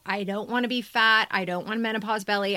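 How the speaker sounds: usual speaking voice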